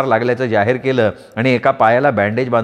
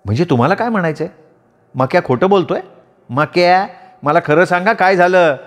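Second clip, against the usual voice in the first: higher, exasperated wife voice; scolding wife; 5 semitones higher than usual. Acted. Wife Manda scolding her clumsy husband, higher voice.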